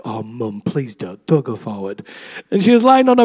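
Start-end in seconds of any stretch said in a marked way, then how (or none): 0.0-2.1 much lower and softer, a mumbled plea; mock the sons' mortified begging under the breath; embarrassed teen boys. Teenage sons beg mum not to go forward, low mortified mumble.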